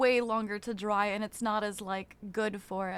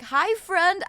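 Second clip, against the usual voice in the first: high, louder called-out hi; mock yelled greeting; 7 semitones higher, 8 dB louder than usual. Miming someone yelling hi into an empty room. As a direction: call across an empty room. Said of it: "like yelling in a room and being like".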